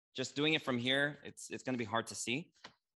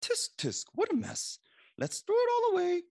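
very high, louder scolding kangaroo; tutting storybook kangaroo, pitched up; 6 dB louder than usual. A narrator's reading. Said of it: "The kangaroo".

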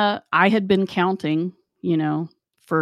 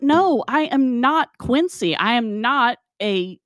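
higher-pitched, exasperated refusal; indignant protest; 8 semitones higher than usual. Retold or imitated. Worker refusing to play doctor for manager, indignant. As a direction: push back in raised-pitch disbelief.

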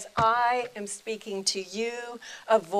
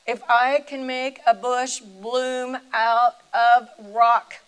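booming divine voice; 3 semitones higher, 10 dB louder, slower than usual. Retold or imitated. The voice of God speaking.

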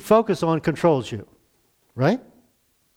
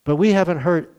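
prayer; 3 semitones lower than usual. Real, not acted. Closing prayer.

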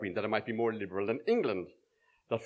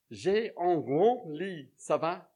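higher, slow landlady offering the bed; slow the offer down like a French hostess; a lightly voiced French madame; French lady; 5 semitones higher, slower than usual. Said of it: "And she consi-, continued".

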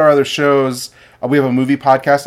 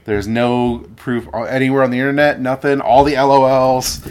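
normal talking voice

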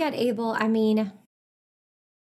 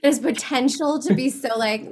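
normal talking voice

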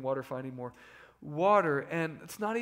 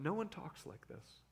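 much softer, almost under the breath; hushed echo; 11 dB quieter than usual. Shown as a demonstration. Preacher repeating the crowd's words in a hush. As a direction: repeat it quietly, letting it sink in.

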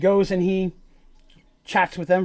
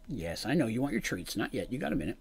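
soft pet talk; 6 semitones lower, 9 dB quieter than usual. Real, not acted. Talking to pet about treats.

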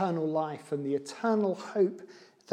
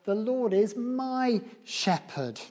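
"my" held long and raised high; slow down and stretch "my" high before shepherd; stress on MY; 5 semitones higher, slower than usual. Shown as a demonstration. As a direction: make "my" the emphatic, drawn-out word. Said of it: "not just the Lord as the shepherd".

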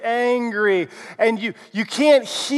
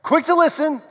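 higher, brisk; quick and bright; 8 semitones higher than usual. Shown as a demonstration. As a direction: call it out high. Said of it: "say it with me and do the hand motions".

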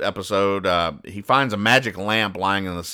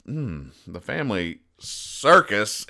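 usual speaking voice

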